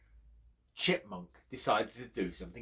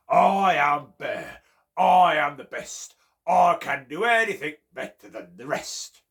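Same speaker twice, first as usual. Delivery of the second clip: boasting bear; 3 semitones higher, 12 dB louder than usual. Acted. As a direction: boom it out like a proud bear. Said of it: "He would walk around the forest boasting".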